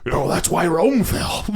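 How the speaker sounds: exaggerated old-time president voice; Richard Nixon. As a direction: do a mock politician impression. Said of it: "In his fucking voice, too".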